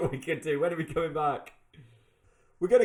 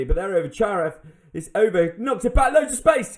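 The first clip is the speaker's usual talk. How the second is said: rising, louder and higher play-by-play; excited commentary; 5 semitones higher, 9 dB louder than usual. Real, not acted. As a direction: call the attack as it builds. Excited live commentary on attack.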